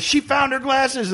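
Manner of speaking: high, slow happy announcement; imitating the singer. Singer announcing to the crowd she found her glasses, high and slow. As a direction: pitch it way up and slow, sharing good news. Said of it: "he goes".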